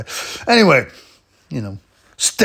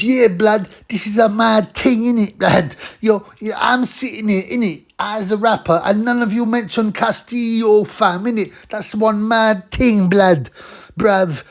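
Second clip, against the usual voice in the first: hammed-up London street slang, much higher; 9 semitones higher, 4 dB louder than usual. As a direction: whine as the self-important rapper. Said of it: "then [name] says".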